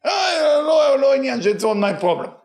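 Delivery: high, loud dismissive roommate voice; mimicking a careless roommate. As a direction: toss it off like a careless girl who won't help. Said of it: "And I said".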